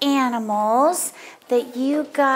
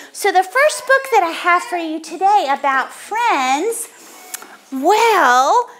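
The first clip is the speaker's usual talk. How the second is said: normal talking voice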